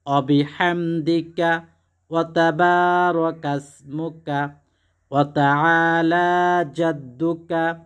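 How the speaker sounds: each Arabic syllable drawn out very slowly, a measured chant; slow careful recitation. Shown as a demonstration. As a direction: recite the prayer slowly, syllable by syllable, as a model to copy. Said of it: "এভাবে পাঠ করা শুরু করবেন".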